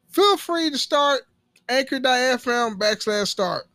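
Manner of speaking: loud, hammed-up podcast ad pitch; ad read. Podcaster pitching to would-be podcasters, loud ad read. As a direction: belt out the sponsor copy high and loud.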